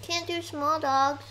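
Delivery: ordinary talk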